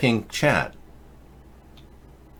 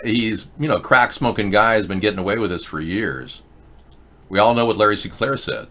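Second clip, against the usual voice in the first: ordinary talk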